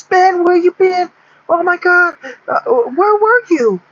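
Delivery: freaking out. Acted. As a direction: squeal it like stunned Texans losing it. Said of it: "they're freaking out and shit".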